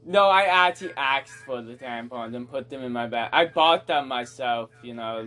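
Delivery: normal talking voice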